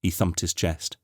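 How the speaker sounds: ordinary delivery, nothing marked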